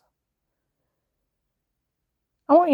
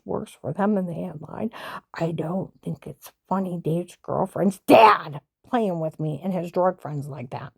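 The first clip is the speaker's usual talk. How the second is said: ordinary talk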